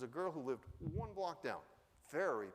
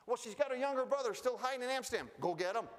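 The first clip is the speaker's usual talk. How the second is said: brisk, quick, higher wife voice; wife giving orders; 10 semitones higher, 5 dB louder, faster than usual. Retold or imitated. Wife Ming sends Franz off to fetch the hidden brother, brisk. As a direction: tell him to go at once, no debate.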